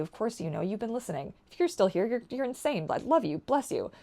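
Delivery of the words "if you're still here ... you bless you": usual speaking voice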